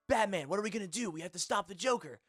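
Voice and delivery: put-on high, loud, urgent boy-sidekick voice; young Robin. Shown as a demonstration. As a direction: call out urgently in a high, youthful inflection. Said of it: "the high inflection for young Robin".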